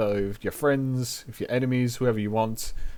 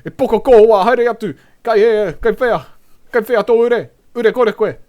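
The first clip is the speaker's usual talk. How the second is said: full loud high-pitched yell; yelling in Māori; 10 semitones higher, 12 dB louder than usual. Shown as a demonstration. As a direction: yell it at someone across the yard. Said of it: "when I fucking yell in Māori".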